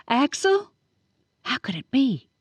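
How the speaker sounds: plain reading voice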